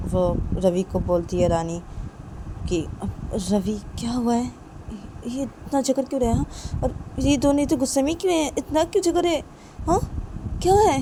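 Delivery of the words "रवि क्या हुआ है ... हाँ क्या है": lightly voiced higher girl, climbing in pitch; puzzled questions that rise as they go; Rani, puzzled on waking. Rani woken by the quarrel asks Ravi, puzzled.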